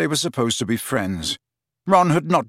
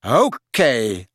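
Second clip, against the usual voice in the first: drawn-out, wary okay; 2 semitones lower, 3 dB louder than usual. Acted. Friend eyeing lovesick mate, wary. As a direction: humour him warily. Harry humours his odd friend.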